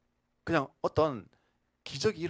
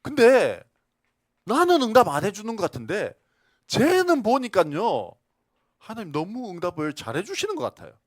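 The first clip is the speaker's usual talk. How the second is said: much louder, higher jealous complaint; indignant protest; 7 semitones higher, 13 dB louder than usual. Retold or imitated. Jealous believer loudly protests to God about unfairness. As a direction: demand fairness loudly, voice climbing. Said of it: "막 따졌어요".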